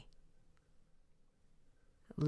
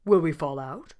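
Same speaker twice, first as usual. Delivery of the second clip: lion wondering; 9 dB louder than usual. A narrator's reading. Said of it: "said Leonard".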